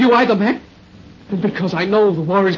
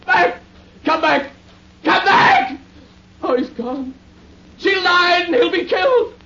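crying out; 7 semitones higher, slower than usual. Acted. Soldier left behind despairs.

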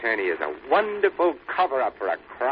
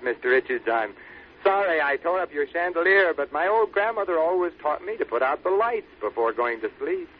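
usual speaking voice

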